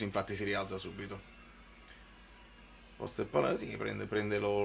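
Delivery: normal talking voice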